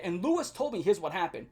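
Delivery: usual speaking voice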